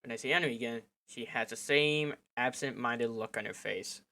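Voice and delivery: ordinary talk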